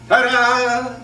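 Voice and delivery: loud, high ta-da; ta-da flourish. Shown as a demonstration. Teacher ends the lesson point, showy ta-da. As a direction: announce it like a magician. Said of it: "fresh and new and".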